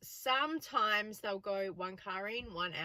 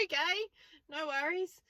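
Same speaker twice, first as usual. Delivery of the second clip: higher, rising mock-casual okay; a higher, lightly nervous no worries; nervous mock reply; 9 semitones higher than usual. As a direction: play it airy to hide the nerves. Replaying a nervous pass by the pub.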